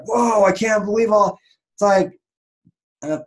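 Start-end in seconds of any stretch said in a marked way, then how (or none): 0.1-1.4 mock astonishment. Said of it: "you're like".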